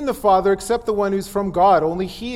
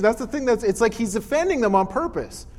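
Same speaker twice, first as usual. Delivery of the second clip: normal talking voice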